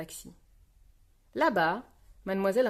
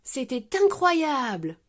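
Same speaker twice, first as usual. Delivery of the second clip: loud, slow, higher exclamation; amazed exclamation; 5 semitones higher, 5 dB louder, slower than usual. A narrator's reading. Peppa to ski teacher, amazed exclamation. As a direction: cry out, stretching it in wonder. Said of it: "s'écrie Peppa".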